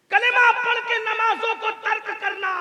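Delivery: high, loud sustained cry; impassioned accusation. Real, not acted. Preacher condemning Muslims who abandon prayer, high loud cry. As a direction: hurl the charge out over the crowd.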